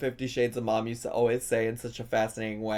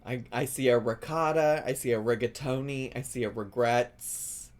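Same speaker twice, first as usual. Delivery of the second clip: ordinary talk, nothing marked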